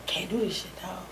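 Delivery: whispered, drained and hopeless; a hushed, beaten whisper in the dark; broken whisper. Retold or imitated. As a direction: whisper it like you've given up. Retold despair at his lowest.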